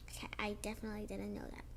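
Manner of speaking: fast whisper to the viewers; quick secret confession whispered; whispered aside. Real, not acted.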